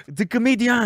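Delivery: put-on high French-accented exclamation; hammed-up high French voice; mock French accent. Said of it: "can't even do a French, like, mock".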